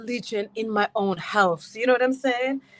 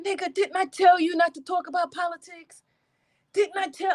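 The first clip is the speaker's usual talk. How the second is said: scolding; 7 semitones higher than usual. Retold or imitated. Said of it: "I said".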